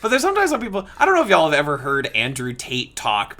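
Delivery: normal talking voice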